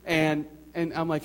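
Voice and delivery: normal talking voice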